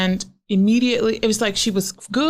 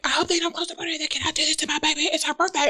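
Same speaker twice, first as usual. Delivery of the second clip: freaking out; 6 semitones higher, 4 dB quieter, faster than usual. Retold or imitated. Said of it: "I was kinda freaking out".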